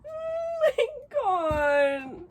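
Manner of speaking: very high, very slow, wailing joyful sob; crying out, overwhelmed by kindness; tearful cry. Real, not acted. Overwhelmed opening friend's gift.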